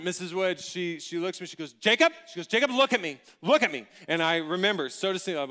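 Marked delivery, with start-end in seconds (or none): 1.7-2.1 high, loud name call; insistent teacher. Retold or imitated. Teacher demands pupil's attention. 2.5-3.9 loud, urgent command; insistent teacher. Retold or imitated. Teacher demands eye contact.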